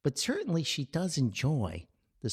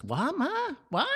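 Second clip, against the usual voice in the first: surprised; 3 dB louder than usual. Retold or imitated. Stunned reaction to mother.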